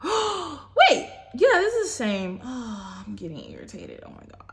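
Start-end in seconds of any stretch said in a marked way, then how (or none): 0.0-2.4 disbelief. Real, not acted. Another duplicate card pulled.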